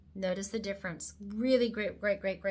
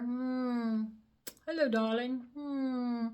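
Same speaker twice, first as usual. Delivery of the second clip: flirty self-admiration; 3 semitones higher, much slower than usual. Acted. Admiring her cheekbone in the mirror.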